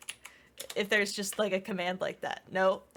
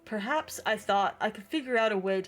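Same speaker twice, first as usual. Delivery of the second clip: story narration. Acted. Reads game narration aloud.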